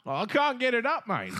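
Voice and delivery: very high, loud panicked begging; pleading with the creepy man, high and loud; mock panicked plea. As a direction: plead in a hammed-up frightened squeal.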